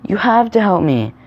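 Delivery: high, loud acted pleading; begging. A narrator's reading. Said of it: "Sam begged them to come".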